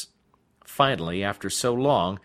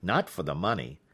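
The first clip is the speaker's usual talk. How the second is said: ordinary delivery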